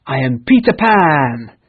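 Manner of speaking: loud, slow triumphant declaration; boastful shout; proud cry. Acted. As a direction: proclaim your name to the pirates. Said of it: "he cried".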